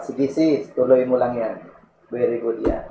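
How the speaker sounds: soft, gentle cheering on; encouraging friend. Retold or imitated. Believer softly cheers on a sister, gentle encouragement. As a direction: encourage her kindly.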